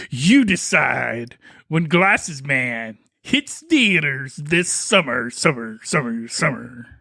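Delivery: slow low announcer, echoing summer fading; trailer echo. Pretend. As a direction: fade out like a reverb.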